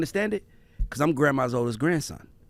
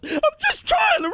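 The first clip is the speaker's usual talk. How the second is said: high, shouted whine of a scolded grown man; whiny excuse. Retold or imitated. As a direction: squeal out the excuse like he's cornered. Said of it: "And he goes".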